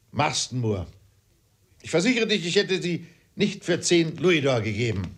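high-pitched protesting father; worked-up father defending himself; indignant father. Acted.

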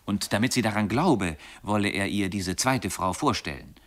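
plain reading voice

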